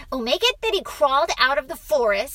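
put-on high, loud, quick child voice; eager boy. A narrator's reading. Boy helps father with story, eager. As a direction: rush the suggestion out.

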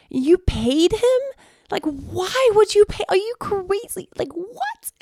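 high, disbelieving squeal at her husband; incredulous outburst. Retold or imitated. Wife scolding husband for paying, in high incredulous disbelief. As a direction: voice shooting up in outrage.

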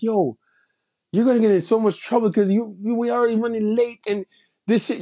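high, fast, excited warning. Retold or imitated. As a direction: blurt it out, stunned and thrilled. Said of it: "my reaction to her was like".

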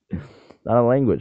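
loud, slow insistence after the laugh; emphatic. Real, not acted. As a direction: lay it down firmly, word by word.

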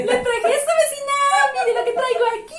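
high, loud put-on neighbour voice; mock neighbour. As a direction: call out high, hamming up the visit. Teasing act of neighbour, loud.

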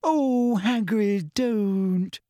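high, falling plaintive moan; dismayed wail, kept at normal volume; Hermione moaning. Acted. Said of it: "moaned Hermione".

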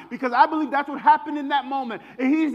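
ordinary talk

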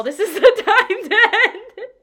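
high, loud teary laugh; real choked-up laughter; laughing through tears. Real, not acted. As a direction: laugh it off as emotion rises. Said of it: "not me getting emotional".

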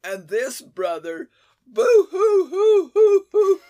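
high, loud mock boo hoo sobbing; fake crying. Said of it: "he was just crying".

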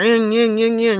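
buzzy vocal chainsaw revs; playful chainsaw sound effect; imitated chainsaw noise. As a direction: mimic a chainsaw revving in repeated bursts.

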